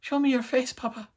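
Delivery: put-on high little Irish-accented child voice; squeaky, pleading mock Irish accent. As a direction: beg as a tiny creature with an Irish accent. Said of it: "threw in an Irish accent".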